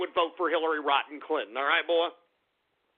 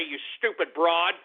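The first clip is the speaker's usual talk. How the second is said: ordinary talk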